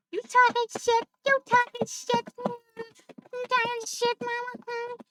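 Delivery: high, loud, singsong taunt; playful pet-teasing. Pretend. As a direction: tease a pet in a high-pitched baby-talk voice.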